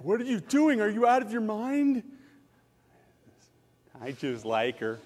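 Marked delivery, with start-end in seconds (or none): 0.0-2.0 a touch of disbelieving buddies; lightly voiced friends scolding; mocking incredulous men. Men warning the pastor he's crazy, mock disbelief. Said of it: "A lot of men told me".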